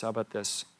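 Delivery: "How about this?" dropped to a soft, hushed aside; soft repeat. Shown as a demonstration. Preacher re-asks his congregation, softly and hushed. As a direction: ask "How about this?" again quietly, almost to himself. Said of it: "same question repeated much softer".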